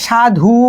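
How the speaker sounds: সাধু with a long u at the end; final u stretched. Shown as a demonstration. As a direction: hold the last vowel u of সাধু. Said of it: "একটা উ উচ্চারণ হচ্ছে কোথায়? শেষে".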